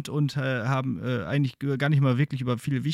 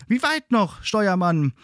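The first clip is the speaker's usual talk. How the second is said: eager passenger; 7 semitones higher, slower than usual. Acted. Passengers ask the helmsman.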